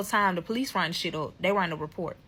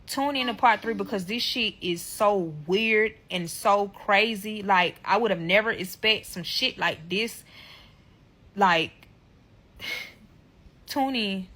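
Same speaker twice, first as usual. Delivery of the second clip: usual speaking voice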